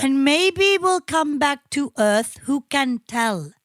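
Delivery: put-on slow, deliberate Swedish accent; mock Swedish accent. Showing the co-host how Swedish lyrics rhyme, mock Swedish accent. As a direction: recite slowly as a Swedish guy. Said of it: "it's how the Swedish sort of talk".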